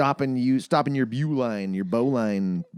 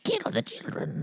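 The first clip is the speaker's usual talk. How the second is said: creepy villain voice; 8 semitones higher, 6 dB quieter, slower than usual. Pretend. Joking creepy threat about kids.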